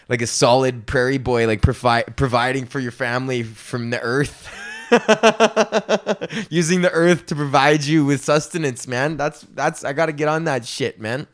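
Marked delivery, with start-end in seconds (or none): 4.5-6.4 long amused laugh. Real, not acted. Laughs at prairie boy bit.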